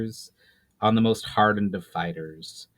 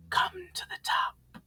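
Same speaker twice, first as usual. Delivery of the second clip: high, slow ghostly whisper; eerie whisper; 5 dB quieter than usual. Acted. Spirit summoning the hero upward, eerie whisper. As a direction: breathe it like a spirit calling from above. Disembodied voice calls from tower.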